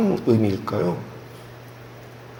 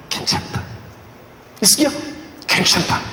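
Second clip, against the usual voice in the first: comforting whisper; 6 semitones higher, 4 dB quieter than usual. Acted. God comforting the dying king.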